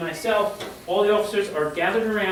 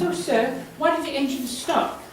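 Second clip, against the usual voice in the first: higher-pitched voice for a woman; imitated woman's voice, raised; woman passenger; 6 semitones higher than usual. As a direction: pitch it up as a puzzled lady passenger asking. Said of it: "the woman comes out ... and she goes".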